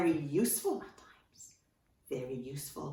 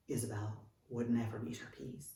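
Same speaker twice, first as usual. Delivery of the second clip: ordinary delivery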